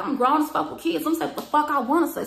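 high, loud and insistent; defiant arguing. Retold or imitated. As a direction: stand your ground loudly. Retold cursing-out argument.

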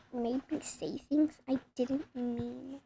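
normal talking voice

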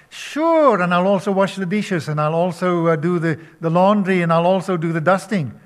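high, loud mock eager agreement; sarcastic. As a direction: pile on the chores with mocking cheer. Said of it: "But I say it with sarcasm".

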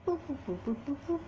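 soft, high beeps of dialing; mock dial tones. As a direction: beep out the phone buttons. Dialing the phone.

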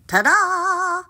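high, proud flourish; drawn-out high fanfare; triumphant ta-da. A narrator's reading. Frog presents the ball.

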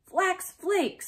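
little sister answering back, pitched high; Emmy's voice. A narrator's reading. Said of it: "said Emmy".

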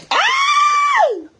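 sudden high, loud scream; startled shriek. Real, not acted. Streamer trying on the hat, startled shriek. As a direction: let out a piercing yelp of fright.

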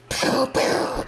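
two airy, mouth-made bangs; imitated gunshots. Lady Shrek shoots both lovers, mouthed gunshots. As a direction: puff out a double "pow".

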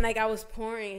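normal talking voice

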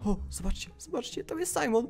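very soft, secretive voice; hushed sneaking. Acted. Boy sneaking near sleeping parents, hushed. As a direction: keep it down, creeping past sleepers. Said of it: "żeby mnie nie usłyszeli".

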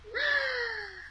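high mock gasp of surprise; surprised gasp. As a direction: gasp to delight the toddler. Mock surprise finding hands.

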